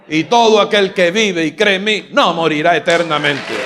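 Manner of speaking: loud, fervent preacher's cry; full-force preaching. Acted. Pastor crying out to the congregation in fervor.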